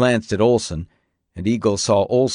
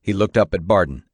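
ordinary delivery

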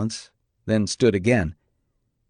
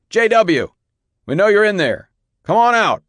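loud, high-pitched call through a door; raised, forceful calling; shouted demand; 10 semitones higher, 5 dB louder than usual. A narrator's reading. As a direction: demand that he come out. Pounding again, calling through door.

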